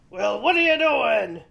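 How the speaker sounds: loud gruff troll demanding. Acted. Troll questions the middle goat, loud and demanding.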